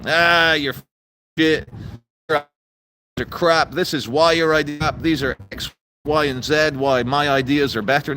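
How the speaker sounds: mock debater. Lightly mocking a protester who argues calmly. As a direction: mimic a polite opponent making his case. Mock opponent's reasonable argument.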